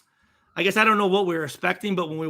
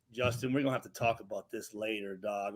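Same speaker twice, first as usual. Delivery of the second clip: quick, much softer aside to a friend; hushed warning; 9 semitones lower, 12 dB quieter than usual. Retold or imitated. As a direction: mutter it under your breath so the family can't hear. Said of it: "we're like".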